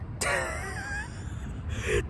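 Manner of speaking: breathy disbelieving scoff; a short laugh of disbelief; incredulous laugh. Real, not acted. Scoffs at taser excuse.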